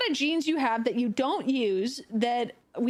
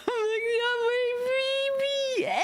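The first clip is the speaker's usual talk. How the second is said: mock sobbing coo; 11 semitones higher, much slower than usual. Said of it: "I'm like".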